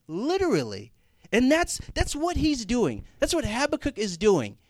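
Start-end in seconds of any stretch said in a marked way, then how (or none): none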